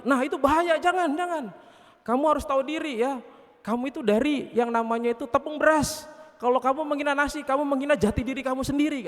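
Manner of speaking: high, urgent motherly scolding; admonishing mother. Acted. Mother cautioning her boastful children, high and urgent. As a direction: warn the child urgently, pitch held high. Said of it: "ke ibunya".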